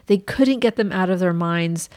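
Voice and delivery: ordinary talk, nothing marked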